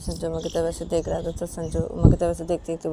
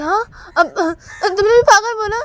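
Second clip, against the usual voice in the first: high, loud, tearful protest; offended Uma; 14 semitones higher, 11 dB louder than usual. Acted. Uma hurt at being called mad, high wail.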